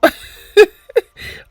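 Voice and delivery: a burst of high, loud laughter. Real, not acted. Vlogger laughing at her turkey comparison, loud and high. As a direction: crack up at your own joke.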